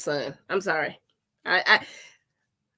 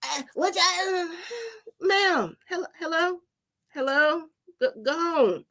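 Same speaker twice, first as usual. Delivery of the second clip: sister mouthing off; 8 semitones higher than usual. Retold or imitated. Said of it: "my little sister just talking shit".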